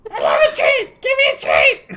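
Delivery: very high, strained squeaky bird voice; strange bird voice. Acted. Axe beak begging Stella for a treat, very high squeal. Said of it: "This is a very strange voice I'm doing".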